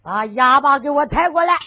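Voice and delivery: loud spoken command, weight on 来; stern order. Acted. Master orders his servants to bring the mute, loud and stern. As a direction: bark it at the servants.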